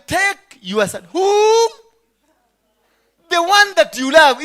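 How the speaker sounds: slow sneering challenge; sarcastic God. Acted. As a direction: needle him with sarcasm. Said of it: "this very sarcasm of God".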